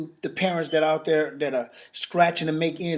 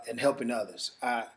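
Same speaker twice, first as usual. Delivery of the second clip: ordinary talk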